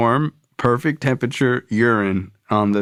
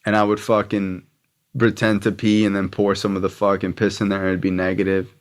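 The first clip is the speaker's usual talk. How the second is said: usual speaking voice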